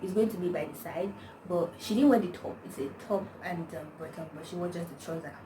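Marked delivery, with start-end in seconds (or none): none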